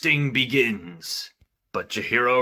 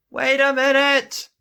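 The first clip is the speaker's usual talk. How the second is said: calling out; 11 semitones higher, 4 dB louder than usual. Acted. Father calling out after Chihiro.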